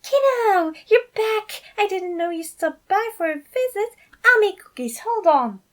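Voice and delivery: very high, loud gushing; bubbly fatherly excitement; overjoyed dad. Acted. Patton tackles Alex in hug.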